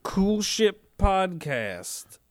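very slow, drawn-out show name; slow emphatic. Real, not acted. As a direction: stretch out each word of the name.

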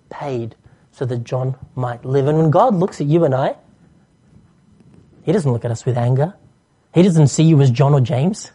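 ordinary talk, nothing marked

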